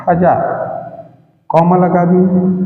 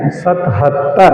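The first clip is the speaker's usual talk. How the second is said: slow careful; 3 semitones lower, much slower than usual. Shown as a demonstration. Teacher reads सतहत्तर slowly so children hear each syllable. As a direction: draw out सतहत्तर for the class. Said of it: "इसको पढ़ते हैं".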